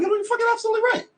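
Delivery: very high, fast put-on voice; conceding. Admitting to the young guy he was right, high fast squeak. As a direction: gush out the admission in a squeaky rush. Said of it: "And I was like".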